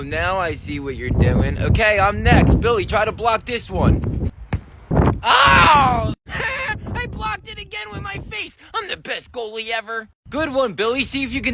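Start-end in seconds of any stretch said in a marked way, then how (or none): 5.2-6.1 loud sharp yelp of pain. Acted. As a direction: yelp as the ball hits. Ball hits face again.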